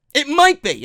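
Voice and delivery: a grudging concession, stressing might. Real, not acted. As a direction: press hard on might. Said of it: "I stress the word might".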